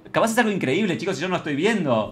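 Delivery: ordinary delivery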